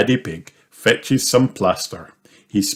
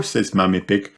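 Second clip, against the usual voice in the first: ordinary delivery, nothing marked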